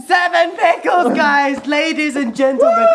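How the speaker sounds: high, loud triumphant announcement; excited. Real, not acted. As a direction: announce the record like a showman. Announcing world record.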